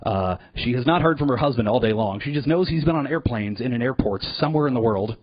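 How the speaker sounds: ordinary talk, nothing marked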